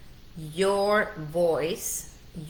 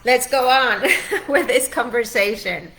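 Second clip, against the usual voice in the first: bright, louder, breaking into a laugh; higher tearful laugh mid-sentence; laughing through tears; 5 semitones higher, 7 dB louder than usual. Real, not acted. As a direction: laugh off the emotion and push on. Recovering after getting emotional.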